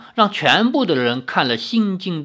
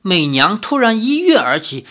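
ordinary delivery

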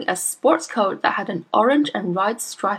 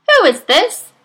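shrill mother voice; 12 semitones higher, 9 dB louder than usual. A narrator's reading. Mother distracted by TV.

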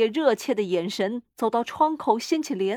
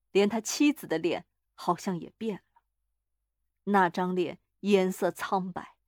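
usual speaking voice

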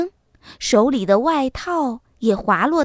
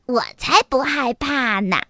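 proud little-fox denial; smug. A narrator's reading. Little fox telling mama it isn't scared, smugly. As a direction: boast lightly, pleased with yourself. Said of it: "小狐狸一脸得意地说".

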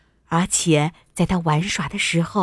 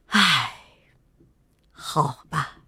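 low, resigned royal sigh; 5 semitones lower, slower than usual. A narrator's reading. King giving in to his daughter, sighing. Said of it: "国王叹了口气".

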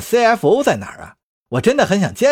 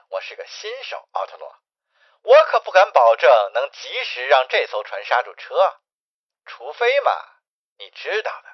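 lowered, understated phone menace; coolly threatening agent; 5 semitones lower, faster than usual. Acted. Agent threatens to crash the ship, coolly taunting.

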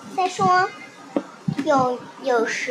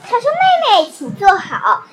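bossy teacher; 6 dB louder than usual. Acted. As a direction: call the class to order like a strict little teacher. 丽兹扮老师发号施令. (高声神气的小老师腔)

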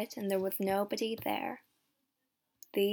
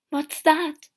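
higher, louder alarmed question; raised-voice startled question; startled cry; 6 semitones higher, 9 dB louder than usual. A narrator's reading.

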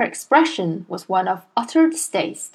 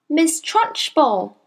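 put-on higher, slow child's answer; small boy; 6 semitones higher, slower than usual. A narrator's reading. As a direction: answer like a careful little boy.

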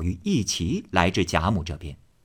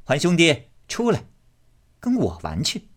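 high, bright call of a bossy sister-in-law; a lady calling to a boy; 8 semitones higher than usual. Acted. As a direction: call him out of the room, teasing. Xifeng calls Jia Huan out.

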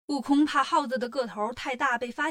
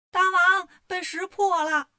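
high, loud call, last words drawn out; alarmed call; 5 semitones higher, 5 dB louder, slower than usual. A narrator's reading. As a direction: cry out the bad news to your king. Minion runs back with bad news.

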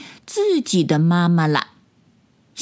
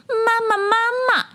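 put-on very high childlike calling; tadpole calling out. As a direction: call for mama as a tiny tadpole. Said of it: "连声大喊". (小蝌蚪尖声喊妈妈)

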